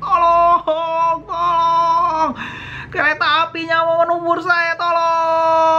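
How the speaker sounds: high wailing pleas for help; panicked screaming. Retold or imitated. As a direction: re-enact a child shrieking in terror. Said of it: "Saya teriak-teriak". (jeritan panik minta tolong)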